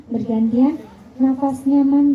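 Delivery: normal talking voice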